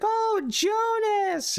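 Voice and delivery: high, drawn-out gushing coo; loving, happy greeting. Retold or imitated. Wife greets her bulldog, loving and happy. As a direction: imitate a doting owner greeting a pet. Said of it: "greets him all loving and happy".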